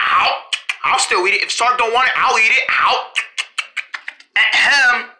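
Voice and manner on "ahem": pointed throat clear for attention; a deliberate ahem to be noticed. Acted. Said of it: "What's with the ahem?".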